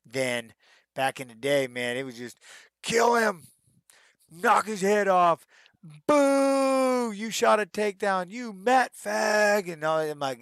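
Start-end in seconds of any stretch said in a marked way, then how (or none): 2.8-9.7 high, loud jeering from the stands; heckling crowd. Retold or imitated. Crowd jeering a wrestler for a takedown, mock yelling. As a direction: bark insults like a bloodthirsty fan.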